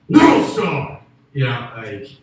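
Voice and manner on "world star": high, loud hype yell; yelling World Star. Retold or imitated. Bystander at a street fight, yelling loud and high. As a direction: scream it like a fight crowd. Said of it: "of yelling, like".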